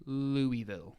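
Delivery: "Louisville" carefully as LOO-ee-vil; measured "Louisville" with an "ee" in the middle; French-style pronunciation. Shown as a demonstration. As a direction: spell out "Louisville" in clear syllables, no s. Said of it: "It's pronounced".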